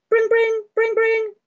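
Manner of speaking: high sing-song bring bring; phone ring imitation.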